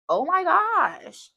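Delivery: high, slow, stretched-out gush; excited gasp. Real, not acted. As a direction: gush it out, thrilled. Excited gush over love reading.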